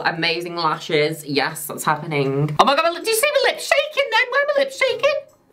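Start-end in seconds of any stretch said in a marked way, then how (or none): 2.6-5.4 very high, rapid squeaky disbelief; squeaky, hurried astonishment; startled squeal. Real, not acted. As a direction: squeal it fast in amused shock. Startled by own shaking lips.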